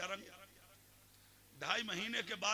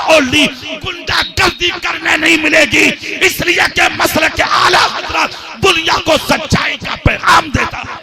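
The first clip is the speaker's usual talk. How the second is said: much louder sermon delivery; full-force preaching; 7 semitones higher, 28 dB louder than usual. Acted. Preacher telling the crowd the dream story, roaring sermon. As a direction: roar the tale over the gathering.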